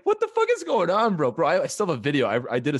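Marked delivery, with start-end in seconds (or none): 0.0-1.3 very high-pitched bewildered cry; mock alarm. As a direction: imitate an alarmed friend, voice shooting way up. Retelling his boy's alarmed reaction.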